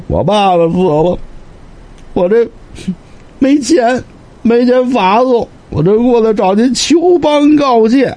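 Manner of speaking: high-pitched, halting sobbing voice; acted weeping, begging in broken phrases; tearful plea. Bereaved scholar asking the lord for burial money, tearful. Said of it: "说着就哭了".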